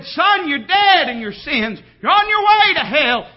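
high, loud preacher's shout; forceful voice of divine rebuke; thundering warning. Acted. Acts God convicting him of sin.

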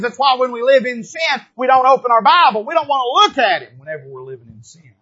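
loud rising preacher's refrain; preaching at full force. Acted. Pastor driving the point at his congregation, loud preaching. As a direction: hammer the refrain home to the church.

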